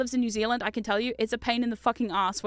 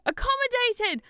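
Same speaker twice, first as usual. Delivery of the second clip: relieved discovery; 13 semitones higher, slower than usual. Real, not acted. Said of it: "thank you".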